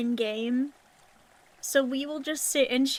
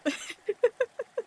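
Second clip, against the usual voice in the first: high giggle; 9 semitones higher than usual. Real, not acted. Laughs at frog situation.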